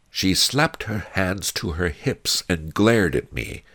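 usual speaking voice

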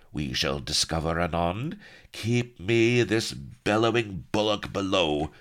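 menacing order rising in pitch; hissed. A narrator's reading. Pirate ordering his prisoner taken below, hissing. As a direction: command it with theatrical villainy. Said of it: "he hissed".

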